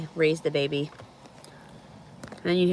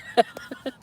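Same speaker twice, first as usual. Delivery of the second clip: breathy laugh; 7 semitones higher than usual. Real, not acted. Laughs at comment.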